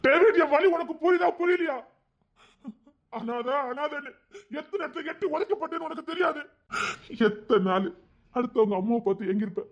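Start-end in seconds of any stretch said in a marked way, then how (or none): 0.1-1.8 anguished plea. Acted. Kings pleads in anguish. 3.2-6.4 put-on crying like a boy. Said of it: "சின்ன பையன் மாதிரி அழுதுகிட்டு இருக்க".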